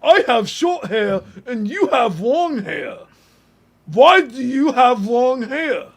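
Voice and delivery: loud, high, slow dopey caricature; mocking online commenters. Streamer mimicking people online obsessed with long hair, loud slow mocking voice. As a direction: drawl it out loudly in a put-on silly voice. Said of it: "people online who just wanna be like".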